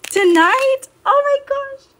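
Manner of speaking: gasp, then very high giddy exclamations; thrilled, high-pitched fangirl outburst; real excitement. Real, not acted. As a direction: squeal it, overjoyed at the sudden news. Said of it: "I got a little excited for that show".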